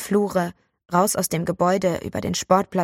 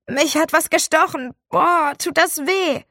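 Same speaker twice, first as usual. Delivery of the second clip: faked pain; 10 semitones higher, 4 dB louder than usual. Boy fakes a sting to the teacher, loud high whining.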